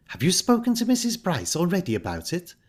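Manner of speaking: high-pitched surprised question. A narrator's reading. Flora hearing that Ida has asked already, surprised. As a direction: ask in surprise, voice lifted high. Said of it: "said Flora in surprise".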